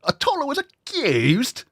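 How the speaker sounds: high mock British disbelief; British accent, disbelieving. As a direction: overplay a shocked Brit. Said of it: "making fun of the accent".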